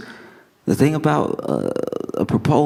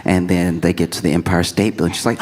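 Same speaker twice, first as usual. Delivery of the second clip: ordinary talk, nothing marked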